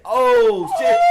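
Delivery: exclaim. Retold or imitated. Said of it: "I said".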